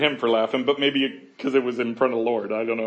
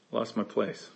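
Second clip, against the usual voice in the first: ordinary delivery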